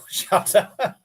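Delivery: shouted shut up with a laugh; yell. Retold or imitated. Yelling at the noisy kitchen, half laughing. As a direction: bark it out over the kitchen noise. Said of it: "had to pause to yell".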